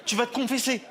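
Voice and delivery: lightly voiced nun wagging a finger; scandalized nun. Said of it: "elle me dit".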